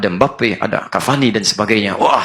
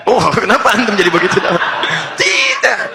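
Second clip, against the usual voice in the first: laughing; 8 semitones higher than usual. Real, not acted. Preacher mimicking the husband's denial, laughing high. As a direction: laugh it out in disbelief.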